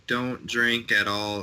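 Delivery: normal talking voice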